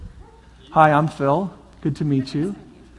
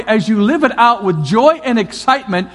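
ordinary delivery

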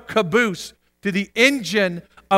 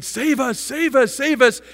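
mild, raised repeated cry; mock yell; 6 semitones higher, 3 dB louder than usual. Preacher imitates believers starting in the spirit, mild yell. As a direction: imitate yelling, only partly let out.

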